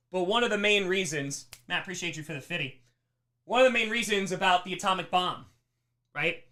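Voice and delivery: ordinary talk, nothing marked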